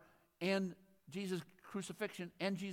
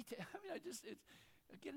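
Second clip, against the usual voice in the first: ordinary talk, nothing marked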